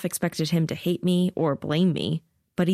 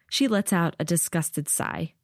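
plain reading voice

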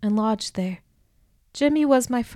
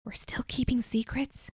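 soft unvoiced whisper; lowered voice; 3 semitones higher, 7 dB quieter than usual. A narrator's reading. Pero whispers to Carper in front of the guards, hushed whisper. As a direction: hush it so the guards won't hear. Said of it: "I lowered my voice".

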